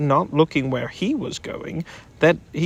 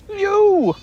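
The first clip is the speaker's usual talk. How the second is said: short high squeak, much higher and louder; 6 dB louder than usual. Acted. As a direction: squeak it out from under the weight. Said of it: "squeaked Piglet".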